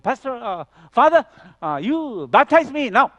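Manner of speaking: high, emphatic plea in slow, broken phrases; boy's halting speech. Retold or imitated. Refugee boy asking the pastor for baptism, halting imitation. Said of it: "This is how he talks".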